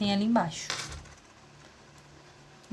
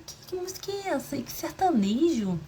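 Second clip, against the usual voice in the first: surprised question; 6 semitones higher than usual. Retold or imitated. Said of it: "eu falei".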